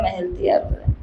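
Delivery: a soft, fading repeat; fading murmur. Shown as a demonstration. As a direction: let it trail off softly like a secret. Repeated phrase, fading away.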